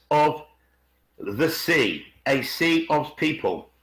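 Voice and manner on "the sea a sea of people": normal talking voice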